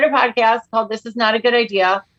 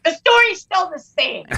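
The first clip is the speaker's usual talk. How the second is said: slow, higher mock-tearful whine; half-hearted fake crying protest; 7 semitones higher than usual. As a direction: whine it like a wounded kid. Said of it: "I'm like".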